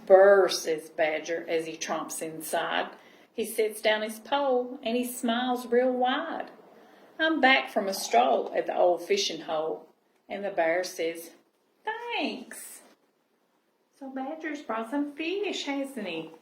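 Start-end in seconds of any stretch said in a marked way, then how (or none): 0.0-11.3 gentle storybook reading voice; cosy read-aloud storytelling; reading to children. Acted. Reading picture book aloud. 11.8-12.8 high, lightly voiced bear saying thanks; a hint of a shy bear, pitched up; bear's thanks. Bear character line in story.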